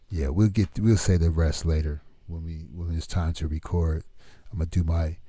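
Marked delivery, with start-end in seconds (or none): none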